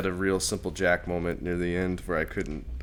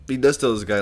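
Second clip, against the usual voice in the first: normal talking voice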